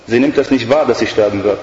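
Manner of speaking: normal talking voice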